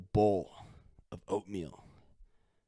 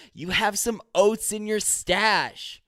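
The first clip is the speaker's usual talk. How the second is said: higher, louder, slow mock wife voice; wife teasing; 12 semitones higher, 11 dB louder, slower than usual. Wife points out oats in his mustache, in a higher, slow teasing voice. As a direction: giggle it out as the wife. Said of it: "and she says".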